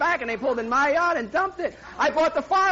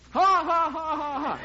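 hammed-up retold laughter of mocking workers; 3 semitones higher, slower than usual. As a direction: imitate the boys jeering at him. The boys mocking him.

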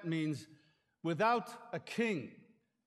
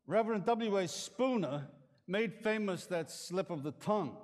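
normal talking voice

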